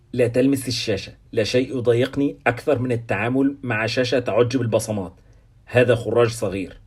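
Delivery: ordinary delivery, nothing marked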